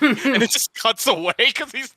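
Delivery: ordinary talk, nothing marked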